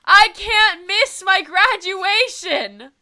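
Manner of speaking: slow, loud, high frustrated wail; exasperated outburst. Real, not acted. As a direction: stretch each word out in loud despair. Graduation clashes with TwitchCon.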